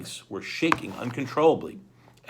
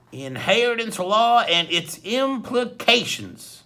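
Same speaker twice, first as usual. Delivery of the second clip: slow, louder, sharply read title; 10 semitones higher, 7 dB louder, slower than usual. A narrator's reading. Hook-handed man reads the book title sharply at Klaus. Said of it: "he said sharply".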